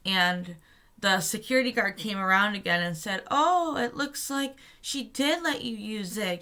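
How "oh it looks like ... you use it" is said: retold jeer in a higher pitch; mocking. Security guard mocking protesters over borrowed sidewalk, mocking. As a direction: imitate someone mocking you, pitched up. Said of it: "just mocking us".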